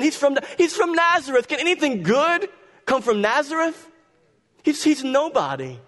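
high sneering scorn; scornful. Acted. Pharisees scorning Jesus.

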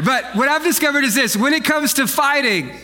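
ordinary talk, nothing marked

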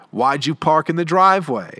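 mock-irate demand, voice jumping up; pissed off. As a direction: snap it like an annoyed dad. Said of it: "He was just all pissed off".

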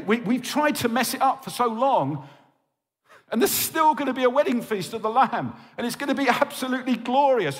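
high, impassioned pulpit build; fervent sermon crescendo; rousing preaching. Acted. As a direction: lift the room toward glory. Climactic preaching about the wedding feast.

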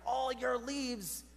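mock yelling neighbour. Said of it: "he starts yelling and he's like".

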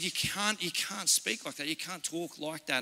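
hushed, sorrowful telling-off; quietly retold fatherly reproof; soft reproof. Dad retells gently telling off daughter.

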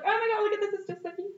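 high-pitched, badly faked Scottish; terrible Scottish accent. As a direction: do a bad, excited Scottish impersonation. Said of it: "My terrible Scottish accent, mind you".

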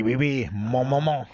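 joking, nonsense-French patter; mock French accent. Host riffing on the French accent answer, mock French. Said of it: "to put on a French accent".

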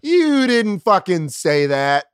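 slow indignant complaint; contrarian objection. Retold or imitated. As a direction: draw it out in disbelief. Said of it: "where I'm like".

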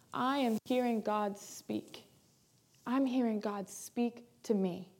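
slow, soft, measured wonder; hushed reflection. Acted. Believer imagining God speaking to them, hushed reflection. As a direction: let each word sink in quietly. Voicing the listener's awed inner thought.

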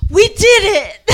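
loud, laughing victory cry; triumphant cheer. Retold or imitated. Podcaster reliving the movie finally happening, triumphant cheer. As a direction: celebrate with a laugh in it.

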